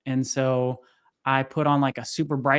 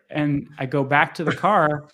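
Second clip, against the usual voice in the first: ordinary talk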